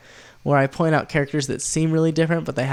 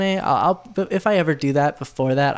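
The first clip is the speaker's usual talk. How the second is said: usual speaking voice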